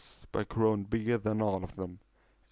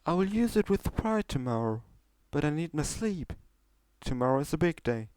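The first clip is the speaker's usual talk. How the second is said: higher, pleased showmare voice; proud but sleepy, wrapping up the night; Trixie's voice; 6 semitones higher than usual. Acted.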